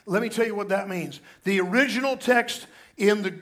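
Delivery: ordinary talk, nothing marked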